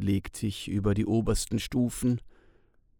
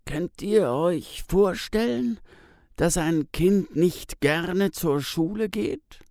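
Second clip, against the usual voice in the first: higher, slow, questioning man's voice; Tis fragt; 7 semitones higher than usual. A narrator's reading. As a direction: ask it slowly and gently, pitched up. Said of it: "Dann fragt er". (behutsam fragend, hoher langsamer Ton)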